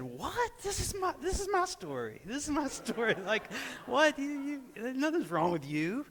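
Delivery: high-pitched mock outrage; indignant wife. Injured wife upstaged by her husband, mock indignant. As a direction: imitate an incredulous wife, voice shooting up.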